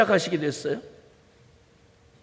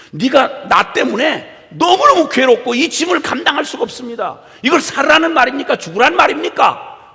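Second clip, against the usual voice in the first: acted exasperated protest, building louder and higher; irritated complaint; 5 semitones higher, 4 dB louder than usual. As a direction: play a fed-up believer protesting to God. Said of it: "그 짜증내고 신경질 내는 말".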